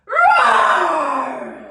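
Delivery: huge roaring shout, high and very loud; lion's roar. Shown as a demonstration. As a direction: let out a full lion's roar. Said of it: "getting ready for our lion's roar".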